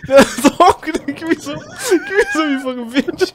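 high, laughing half-cry plea; laughing whine. Real, not acted. Grabbing at the air tank from his friend while laughing. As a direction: beg through helpless laughter.

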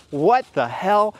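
stunned disbelief. Retold or imitated. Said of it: "he just thinks".

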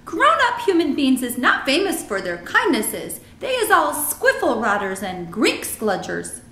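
alarmed giant, high and loud; BFG. A narrator's reading. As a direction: warn emphatically as a friendly giant.

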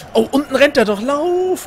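excited urging. Real, not acted. Urging player on screen.

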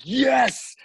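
a high, excited whoop of victory; retold cheer. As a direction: shout it like the band just nailed it. Band cheering after great interview.

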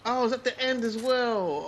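loud, high angry outburst; frustrated shout. Real, not acted. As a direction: blurt it out in anger. Losing the fight.

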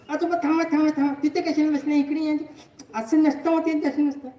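put-on high, insistent staffer stopping an elderly patient; bossy staff member. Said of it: "आणि ते म्हणते".

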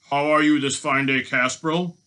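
loud, mock-friendly greeting; taunting false politeness; jeering. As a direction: jeer it like a gloating robber. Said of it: "he jeered at him".

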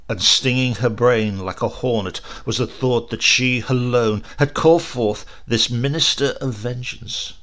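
ordinary delivery, nothing marked

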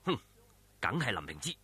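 a low, soft scornful grunt to himself; inner thought. A narrator's reading. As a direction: mutter the realisation inwardly. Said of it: "令狐沖諗".

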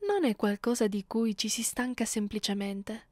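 ordinary talk

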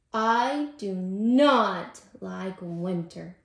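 loud, very slow, emphatic declaration; fed up. A narrator's reading. Tortoise declaring his dislike of winter, fed up. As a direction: spell out displeasure word by word.

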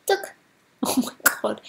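normal talking voice

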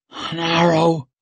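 high, strained croak. Acted. Dazed girl answering the witch, croaked. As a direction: croak it out through a thick tongue. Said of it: "croaked Rika".

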